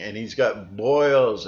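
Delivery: ordinary talk, nothing marked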